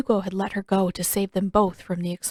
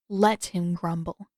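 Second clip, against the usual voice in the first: ordinary delivery, nothing marked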